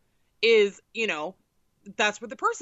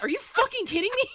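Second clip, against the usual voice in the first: much higher, fast exasperated yell-free rant; incredulous outburst; 12 semitones higher, faster than usual. Retold or imitated. Daughter furious that mom brought no cash, incredulous. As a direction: throw up your hands in disbelief. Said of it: "mad at mom for no cash".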